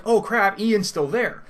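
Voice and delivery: high, loud retold dismay; alarmed realisation. Player remembering a friend left behind, high-pitched mock alarm. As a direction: blurt it as it suddenly dawns on you.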